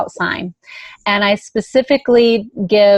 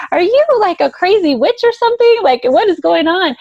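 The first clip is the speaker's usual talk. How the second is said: high, raised-voice freak-out; imitating someone losing it; 10 semitones higher, 4 dB louder than usual. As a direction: act out astonished disbelief, pitch way up. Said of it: "he just lost it".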